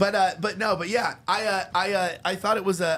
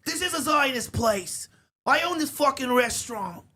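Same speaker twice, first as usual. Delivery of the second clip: high, yelling kvetch; whiny high-pitched complaining; 9 semitones higher than usual. Retold or imitated. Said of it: "He's like".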